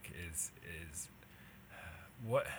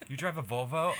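put-on snooty rich kid; 7 semitones higher, 7 dB louder than usual. Mocking rich kids dialogue.

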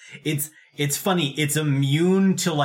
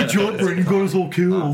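loud, higher sarcastic praise through laughter; teasing, raised-voice sarcasm; mock congratulations; 6 semitones higher, 5 dB louder than usual. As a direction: laughingly heap sarcastic blame on a friend. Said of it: "Now the sarcasm drops".

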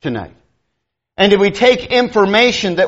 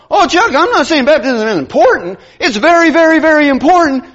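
high, loud mocking stress on very, very important; mock emphatic; 7 semitones higher, 4 dB louder than usual. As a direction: lay it on thick, mocking their very, very. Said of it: "they'll go".

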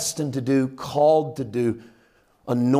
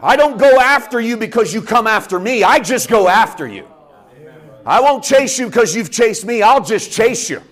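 higher, loud, emphatic preaching; fervent declaration; 6 semitones higher, 6 dB louder than usual. Acted. Jesus declaring love for his audience, loud and fervent. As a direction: proclaim it high and loud.

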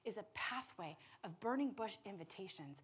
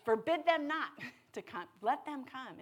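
lofty King James cadence, higher and louder; old-fashioned scripture; 5 semitones higher, 8 dB louder than usual. Pretend. Preacher quoting Jesus in old biblical language, lofty. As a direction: proclaim it in the old words. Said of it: "the old language".

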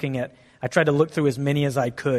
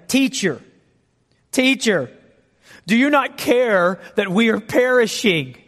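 rising, louder desperate cry of teacher; panicked plea; 7 semitones higher, 5 dB louder, slower than usual. Acted. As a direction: plead in rising panic, slowing on the last line. Disciples panicking in the storm.

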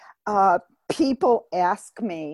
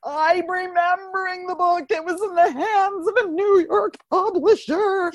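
crying; 9 semitones higher than usual. Retold or imitated. Retold despair, crying to God.

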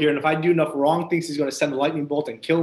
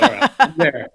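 louder, eager mock acceptance; eager agreement; 7 dB louder than usual. Telling the friend he'll come for free food, eagerly. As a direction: jump at the free dinner offer.

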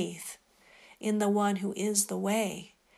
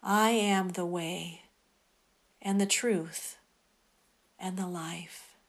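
guided meditation; 4 dB quieter than usual. Acted. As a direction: lead them calmly into prayer. Quoting Jesus in meditation.

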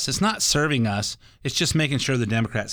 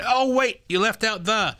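higher, fussy mock correction; imitating engineer; 9 semitones higher than usual. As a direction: nitpick like the studio engineer. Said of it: "your assistant are saying".

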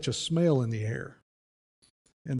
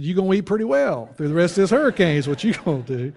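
teasing reply; 5 semitones higher, 7 dB louder than usual. Retold or imitated. Teasing answer.

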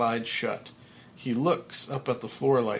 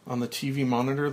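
usual speaking voice

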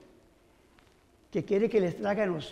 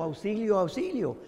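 ordinary delivery

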